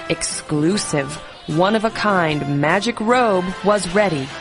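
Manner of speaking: ordinary delivery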